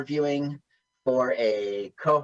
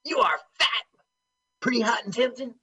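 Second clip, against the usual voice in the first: movie quote voice; 7 semitones higher, faster than usual. Retold or imitated. Quoting a movie line, high voice.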